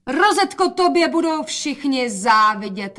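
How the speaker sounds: high, loud cry of admiration; calling out. A narrator's reading. Mother praises dressed-up daughter, calling out. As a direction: call it out high, stretching the words. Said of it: "volala matka".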